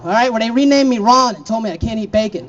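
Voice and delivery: ordinary talk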